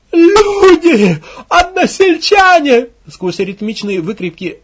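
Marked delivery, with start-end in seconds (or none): 0.1-2.9 very high, loud drawn-out appeal; woman's cry. Acted. Woman calling to fellow villagers, crying out. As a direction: scream out to rouse the villagers. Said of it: "женский крик".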